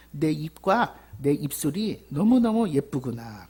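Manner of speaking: slow, fond voicing of Jesus; tender praise. Jesus praising the believer's lips, slow and tender. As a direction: savour each word like loving praise. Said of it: "예수님이 "이쁘다"라고 그러는 거예요".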